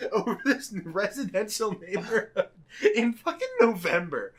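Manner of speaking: ordinary talk, nothing marked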